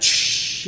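imitated hiss of burning; a sharp "shh" like a sizzle; sizzle sound. Preacher imitates coal burning Isaiah's lips, sizzling shh. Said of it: "as it hit his lips and burned".

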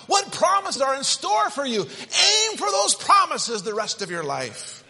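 high, loud preaching shout; shouted exhortation. Acted. Preacher rallying his congregation, loud and high. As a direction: call them upward to the promises.

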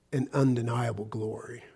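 hushed whisper of awe; soft awed whisper. Real, not acted. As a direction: breathe it out in wonder. Awed close of sentence.

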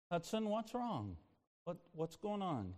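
very soft, hushed questioning; quiet bedtime voice, retold; gentle concerned dad. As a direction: check softly on a frightened child. Father soothing scared son, retold.

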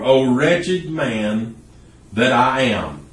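"O wretched man" drawn out very slowly, leaning on "wretched"; slow down and weigh "wretched"; solemn exclamation. Shown as a demonstration. Preacher reading the verse to the congregation, very slow and weighty. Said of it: "with an exclamation point".

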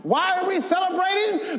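high, loud celebratory cry; shouted Easter question; preacher's shout. Acted. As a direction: call it out to the whole church.

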